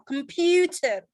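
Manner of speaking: "computer" slowed, with PU drawn out; stress on PU. Shown as a demonstration. Teacher urging students to answer, slow "computer" stressed on PU. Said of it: "Berarti stresnya di computer".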